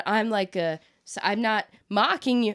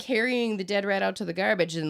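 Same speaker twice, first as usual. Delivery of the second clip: ordinary talk, nothing marked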